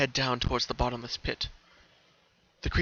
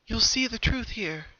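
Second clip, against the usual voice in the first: ghost sister; 9 semitones higher, slower than usual. A narrator's reading.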